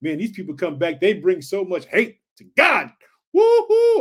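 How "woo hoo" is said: very high, loud whoop of joy; excited whoop. Real, not acted. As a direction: cheer out, pumped up. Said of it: "I'm pumped up".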